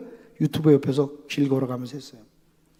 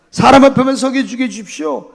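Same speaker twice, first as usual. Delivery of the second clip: ordinary talk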